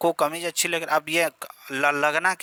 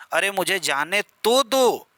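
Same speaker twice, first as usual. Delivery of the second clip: slow, higher pleading line with तो pressed; emphatic plea; 4 semitones higher, slower than usual. Shown as a demonstration. Teacher acting a plea for the class, तो stressed. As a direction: beg to be let go, stressing तो. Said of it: "तो भी निपात का काम".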